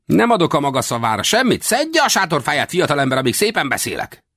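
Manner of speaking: high, scornful threat; angry warning. Acted. Small westerner telling a young man to pack up, high and threatening. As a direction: order the youngster off in a high, irritated voice.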